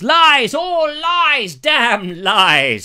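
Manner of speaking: high, loud theatrical denunciation; hammed-up indignant shouting; mock outraged outburst. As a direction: cry it out like a ham actor, then drop in pitch. Mock confession that it's all lies.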